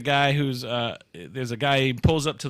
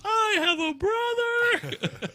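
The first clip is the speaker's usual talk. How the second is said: high, slow squeaky voice; squeaky reveal; much slower than usual. Retold or imitated. Cashier twin in the ad revealing in a high squeak. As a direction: squeak out the twist. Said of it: "He goes".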